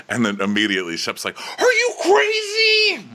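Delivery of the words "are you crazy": very high, loud, drawn-out squeal; imitating a man losing it; mock outraged shout. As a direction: shriek it in hammed-up disbelief. Retold outburst by Shep.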